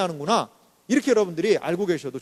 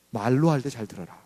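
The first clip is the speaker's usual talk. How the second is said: mock parental threat; 9 semitones lower, 4 dB quieter than usual. Parent warning the child, lightly voiced low threat. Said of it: "이게 바로".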